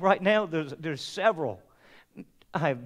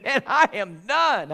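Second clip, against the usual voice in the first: slow, breaking voice of defeat; worn out; 4 semitones higher, 4 dB louder, slower than usual. Retold or imitated. Pastor retelling telling his friend he is done, broken. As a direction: give up, voice cracking. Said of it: "I said".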